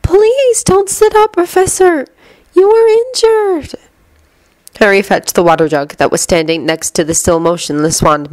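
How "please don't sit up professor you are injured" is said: gentle. A narrator's reading. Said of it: "gently pushing Professor Muto back down".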